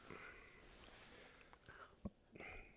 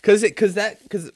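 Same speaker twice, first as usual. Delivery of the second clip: heated protest; 11 semitones higher, 11 dB louder than usual. Real, not acted. Heated argument over the question.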